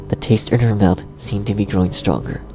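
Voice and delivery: ordinary delivery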